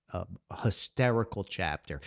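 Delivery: ordinary delivery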